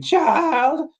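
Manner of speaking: high screeching mock yell; Nicolas Cage scream. Imitating Cage's tortured scene, high mock scream. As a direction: screech the one word. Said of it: "Nicolas Cage screaming".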